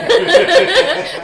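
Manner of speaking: very high loud cackle; evil chuckle. Acted. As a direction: cackle like a mad witch.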